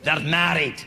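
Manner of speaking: lightly voiced nasty teacher lecturing slowly; science teacher. Teacher presenting toads to the class, mildly gruff.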